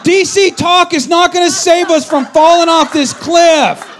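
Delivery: high, loud, slow mock shout; exasperated yell. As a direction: yell it in disbelief. Retold panic on cliff drive.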